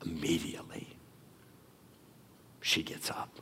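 soft unvoiced whisper; awed whisper. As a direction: breathe it in wonder. Whispered climax.

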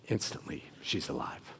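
unvoiced breathless whisper; hushed whisper. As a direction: drop to an awed whisper. Dramatic hush.

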